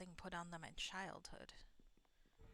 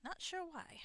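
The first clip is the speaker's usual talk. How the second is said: higher, hammed-up southern accent on "Not sure why"; a self-mocking stab at a southern accent; bad southern accent; 10 semitones higher, slower than usual. Shown as a demonstration. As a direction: try a Southern accent and miss it a little. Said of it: "I'm having trouble with my bad southern accent".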